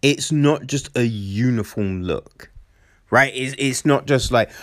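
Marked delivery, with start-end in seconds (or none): none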